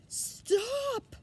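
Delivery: high, loud piglet scream. A narrator's reading. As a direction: shriek it in panic. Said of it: "screamed Wilbur".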